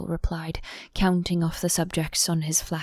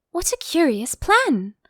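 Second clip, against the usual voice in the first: higher, louder, amazed Alice; exclaiming; 8 semitones higher, 5 dB louder than usual. Acted. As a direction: cry out in wonder. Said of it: "exclaimed Alice".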